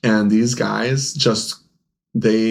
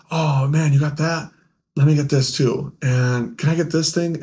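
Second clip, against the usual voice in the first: higher, mildly excited buyer; imitated eager customer; 5 semitones higher than usual. Customer asking store owner for more on credit, eager. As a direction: mimic a customer spotting one more thing.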